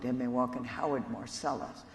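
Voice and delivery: ordinary talk, nothing marked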